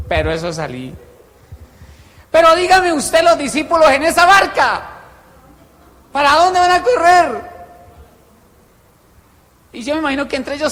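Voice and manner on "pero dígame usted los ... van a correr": high, loud rhetorical outburst; exclaiming preacher, pitch way up; impassioned appeal. Real, not acted. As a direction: throw the question out at full voice. Preacher cries out rhetorical question.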